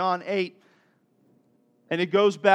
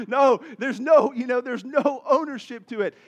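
loud, higher mock despair; exasperated; 6 semitones higher, 8 dB louder than usual. Dad unable to judge the clothing case, exasperated. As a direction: protest in hammed-up exasperation.

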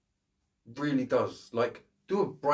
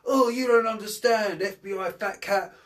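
much higher, louder ranting cop parody; mock renegade cop; 9 semitones higher, 4 dB louder than usual. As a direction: ham it up like a cliché cop yelling at his boss. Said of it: "his boss comes over and he goes".

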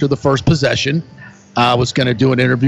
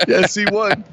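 said through a real laugh, higher; 7 semitones higher than usual. Real, not acted. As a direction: chuckle the words out while agreeing. Laughing agreement about coach.

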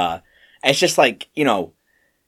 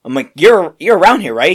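shocked outburst; 7 semitones higher, 8 dB louder than usual. Retold or imitated. Boss confronting manager on phone.

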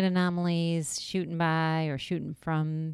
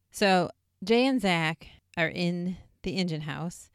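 ordinary talk, nothing marked

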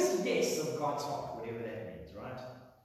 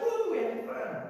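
high, cheering woo; whooping crowd; 10 semitones higher, 3 dB louder than usual. Retold or imitated. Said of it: "Everyone's just like".

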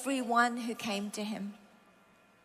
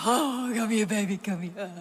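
melting tenderness. Retold or imitated. Mother's heart responds to her toddler, melting tenderness. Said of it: "my heart would go".

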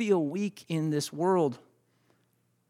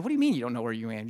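quick, lower, puzzled retort; baffled husband; 5 semitones lower, 3 dB quieter, much faster than usual. Retold or imitated. Husband questions his lost wife on the phone, baffled. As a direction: retell a rapid, disbelieving question. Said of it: "I said".

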